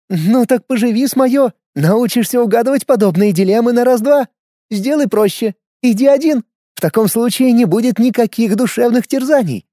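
a hint of a sly older woman; cheeky, knowing woman's voice; lightly voiced Jones. A narrator's reading. (лёгкий голос Джонс)

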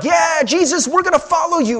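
high, loud eager cheer; eager followers. Retold or imitated. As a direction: sign up with gusto. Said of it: "they're like".

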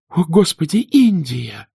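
high shocked cry; exclaiming. A narrator's reading. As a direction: cry out to stop her. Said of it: "воскликнула Мелани".

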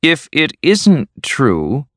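slow, high-pitched, cold challenge. A narrator's reading. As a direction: challenge coldly, stretching each word. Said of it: "said Mavis coldly".

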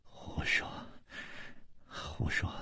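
whispered, breathless rebuke; frowning rebuke. Acted. Sick Chu Liuxiang scolds his friend, faint frowning rebuke. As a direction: scold him weakly under your breath. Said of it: "皱着眉说".

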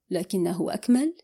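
plain reading voice

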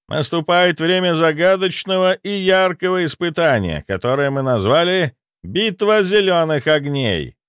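торжественное объявление повышенным голосом; обращайся ко всем командам, высоко и раздельно; ведущий турнира. Acted. (торжественный голос ведущего)

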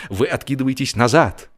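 ordinary delivery, nothing marked

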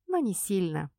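plain reading voice

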